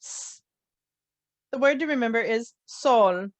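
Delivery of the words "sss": "S" as a long bare hiss; letter sound. Shown as a demonstration. Teacher showing how S sounds, sustained hiss. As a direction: hold "S" as "sss", no vowel. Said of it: "It sounds like".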